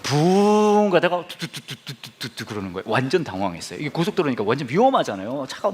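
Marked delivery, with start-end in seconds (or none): 1.3-2.4 imitated engine sputter.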